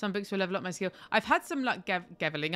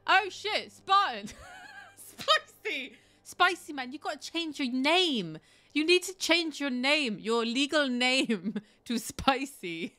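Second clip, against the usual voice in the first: much higher, amused exclaiming; laughing excitement; 7 semitones higher, slower than usual. Real, not acted. Streamer tells Spartan to become Spicy, laughing excitement. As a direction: burst out delighted, laughing between phrases. Delighted reaction to a viewer's joke.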